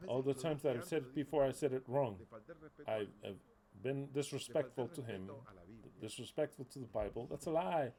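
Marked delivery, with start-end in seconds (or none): none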